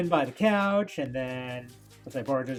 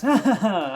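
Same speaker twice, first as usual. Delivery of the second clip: hearty laugh; 7 semitones higher, 6 dB louder than usual. Acted. Doctor laughs in the comic.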